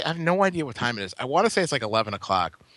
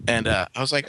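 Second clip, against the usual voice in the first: ordinary talk, nothing marked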